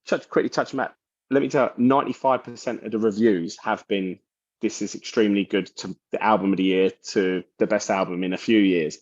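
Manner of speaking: ordinary talk, nothing marked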